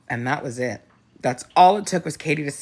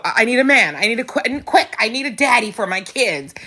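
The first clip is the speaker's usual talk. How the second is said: raised, louder, mock desperate pleading; needy desperate voice; 6 semitones higher, 3 dB louder, faster than usual. Mocking her past self to the host, mock-desperate plea. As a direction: play your past self begging for a man.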